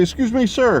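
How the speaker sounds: high-pitched, hesitant politeness, acted out; nervous greeting. Nervous man approaching a stranger at the gas station, high tentative voice. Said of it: "I was a little nervous there".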